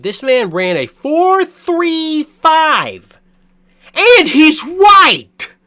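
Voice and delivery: high, loud, stretched-out excited yelling; excited shouting. Real, not acted. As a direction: shout it out in disbelief and joy.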